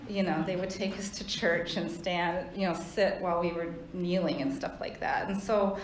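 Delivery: ordinary delivery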